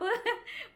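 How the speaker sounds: a sudden high laugh; high burst of laughter. Real, not acted. Wife laughing as husband surprises her at door. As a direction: laugh out in high-pitched delight.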